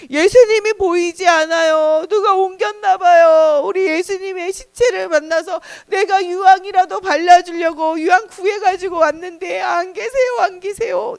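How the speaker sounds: high, sing-song wailing; grieving Mary, voice held high; tearful lament. Acted. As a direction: plead through acted tears. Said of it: "뭐 때문에 울고 있어요".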